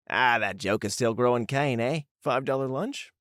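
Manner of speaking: lively put-on Aussie banter; amateur Australian voices trading quips; attempted Australian accent. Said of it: "not sure how good that accent was".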